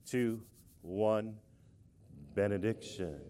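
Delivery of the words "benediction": low, softer, solemn intoning; somber and serious. Shown as a demonstration. Leading the congregation in a somber, serious chant. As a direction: intone it gravely, pitched well down. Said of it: "somber and serious form and fashion".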